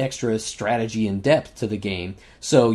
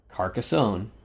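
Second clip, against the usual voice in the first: usual speaking voice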